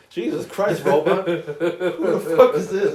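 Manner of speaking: laughing disbelief. Retold or imitated. Startled by a flirting game robot.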